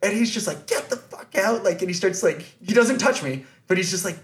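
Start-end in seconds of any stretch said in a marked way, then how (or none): 0.7-1.6 high-pitched mock yell without the volume; retold angry order. Security guard orders fan out, retold angry command. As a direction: imitate a guard throwing someone out.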